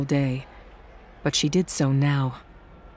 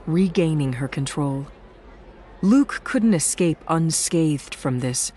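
ordinary delivery

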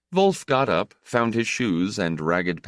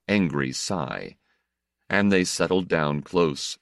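usual speaking voice

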